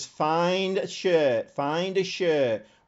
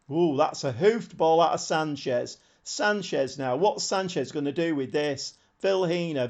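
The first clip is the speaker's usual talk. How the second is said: usual speaking voice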